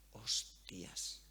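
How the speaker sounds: a hushed, astonished curse; stunned whisper. Retold or imitated. Host recalls her shock at the message, whispered. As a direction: breathe it out, barely voiced, floored. Reacting to a surprising listener message.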